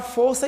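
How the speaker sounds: força with a closed "ô", as in "fôrça". Shown as a demonstration. Teacher naming the noun beside its homograph, closed O in força. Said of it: "Acontece isso também com a palavra".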